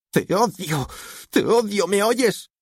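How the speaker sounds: high-pitched hateful outburst; anguished teenage fury; furious daughter. A narrator's reading. Daughter screams hatred at father.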